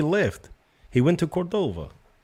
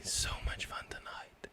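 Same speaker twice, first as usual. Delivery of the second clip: whisper; 17 dB quieter than usual. Real, not acted. Whispered aside.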